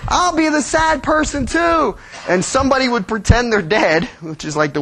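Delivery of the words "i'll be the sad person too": put-on high childish voice, higher; perky little kid taking a role; eager child volunteering to be the sad one; kids playing. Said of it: "they would be like".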